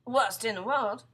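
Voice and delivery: loud, slow verdict; doom-saying husband. Acted. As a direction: lay it on heavily. Husband piles on the dread.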